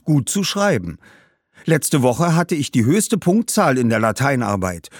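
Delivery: proud schoolboy. Acted. Boy talks of school and dreams. (stolzer Schuljunge, höhere Stimme)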